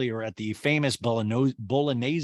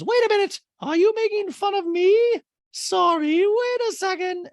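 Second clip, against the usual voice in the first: loud, very high mock indignation; Dorit impression; 6 dB louder than usual. Dorit asking if they mock her, loud squeaky indignation. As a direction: squeal it out, affronted. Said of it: "Dorit goes".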